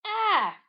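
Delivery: high, loud yelp; sudden high exclamation; short acted cry. Character cries out in comic.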